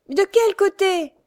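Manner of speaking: high, loud, slow frightened question; desperate plea. A narrator's reading. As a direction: hands clasped, begging for direction. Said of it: "demanda Louise en joignant les mains". (aveugle qui implore, lente, aiguë et forte)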